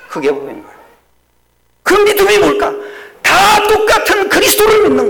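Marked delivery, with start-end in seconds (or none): 1.8-5.1 high, loud preaching cry; preaching at full force. Acted. Pastor to congregation, crying out in full preaching force. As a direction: lift the congregation with a ringing cry. Preacher shouting his climax.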